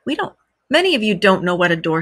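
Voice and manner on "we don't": plain reading voice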